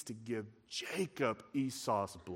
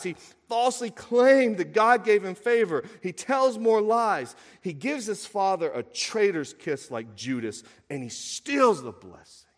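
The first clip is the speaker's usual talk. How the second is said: raised, emphatic preacher voice; forceful preaching; 9 semitones higher, 10 dB louder than usual. Acted. Preacher listing Jacob's sins to the congregation, forceful. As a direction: hammer out each offence, high and driving.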